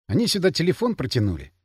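ordinary delivery, nothing marked

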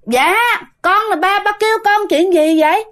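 high voice calling back across the yard. Retold or imitated. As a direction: answer a far-off call from the outhouse. Daughter calls back to father. (con gái cao giọng gọi vọng về nhà)

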